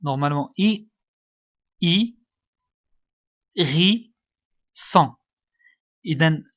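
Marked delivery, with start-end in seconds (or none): 1.8-5.2 "I, ri, son" découpé, chaque syllabe forte et plus haute; détache "I", "ri", "son" avec de longues pauses; syllabe par syllabe. Shown as a demonstration. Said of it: "des syllabes de". ("I... ri... son", dicté fort en trois morceaux à l'élève)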